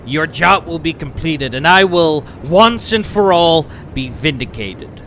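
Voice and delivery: loud, high, furious boast; villain rant. Acted. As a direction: shout down the senator with wounded pride. Villain rants at senator.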